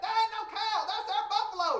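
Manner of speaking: put-on high, loud kid's yell; boy hollering back at his grandpa, high and loud. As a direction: holler it back across the field. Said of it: "Britt yelled back".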